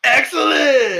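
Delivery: high, loud, drawn-out catchphrase yell; mock exclamation. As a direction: belt it out like an over-the-top dude. Mimicking Keanu's catchphrase after dude.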